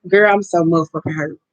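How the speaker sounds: ordinary talk, nothing marked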